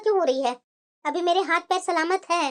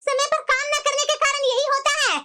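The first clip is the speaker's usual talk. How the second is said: haughty sister-in-law lecturing her, pitched up; bossy sister-in-law; 9 semitones higher than usual. Acted.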